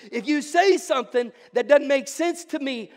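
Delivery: preaching at full force. Real, not acted. Preacher rouses the congregation on surrender, loud and fervent. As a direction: pour it out to the congregation. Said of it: "I'm starting to preach".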